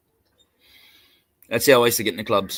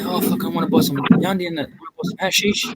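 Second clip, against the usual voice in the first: higher, half-breathed slangy buyer voice; asking to buy pot; 6 semitones higher than usual. Retold or imitated. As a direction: ask for the hash on the sly, pitched up.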